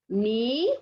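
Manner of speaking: "ni" on its own, drawn out and lifting at the end; teacher sounding out "ni" as an unstressed beat; unstressed syllable. Shown as a demonstration. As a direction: isolate "ni" without punching it. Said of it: "se conoce como átonas".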